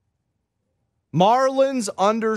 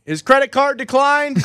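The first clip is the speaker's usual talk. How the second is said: called out; 6 semitones higher than usual. Retold or imitated. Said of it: "you don't gotta call out everything".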